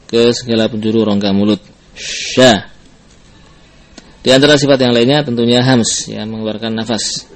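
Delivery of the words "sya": SH of "Sya" with air spreading wide in the mouth; tafasyi. Shown as a demonstration. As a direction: spread the breath through "Sya". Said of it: "kemudian menyebar". ("Sya" dengan udara menyebar di rongga mulut)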